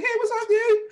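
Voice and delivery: high, loud breezy hello; fake casual greeting. Comedian faking calm to a friend before the show, high and breezy. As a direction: put on a cool front, way up high. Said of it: "But I'm really like".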